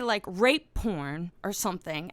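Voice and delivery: ordinary talk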